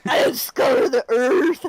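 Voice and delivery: mock monologue through laughter; laughing imitation. Friend repeats the player's silly monologue, laughing imitation. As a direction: imitate the ape-speak, cracking up. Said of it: "all in one big monologue".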